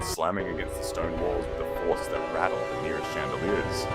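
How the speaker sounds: ordinary delivery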